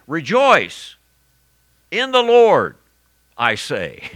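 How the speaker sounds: "Rejoice" set first, then "in the Lord" lifted high and loud; emphatic Rejoice first. Shown as a demonstration. Preacher showing Paul's word order to his congregation, ringing emphasis on "Rejoice". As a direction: put the weight up front on "Rejoice". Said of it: "the first word that pops up in that".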